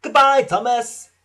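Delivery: high, loud farewell call. A narrator's reading. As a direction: call goodbye in passing. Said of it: "called Bertie".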